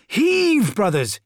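high yell, easing on the last word; yelled command. A narrator's reading. Corvo to brothers levering the pediment, yell. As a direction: yell to spur your brothers on. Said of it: "yelled Corvo".

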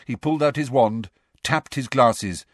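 plain reading voice

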